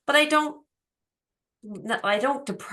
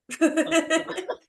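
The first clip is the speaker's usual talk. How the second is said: bright high burst of real laughter; high giggle; 11 semitones higher than usual. Real, not acted. Laughing with her friend about fruit snacks, high giggle.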